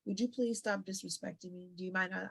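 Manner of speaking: soft, even, unbothered request; quiet high road. Shown as a demonstration. Daughter answering the relative's insult, quiet and composed. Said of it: "while you take the high road".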